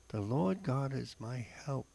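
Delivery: usual speaking voice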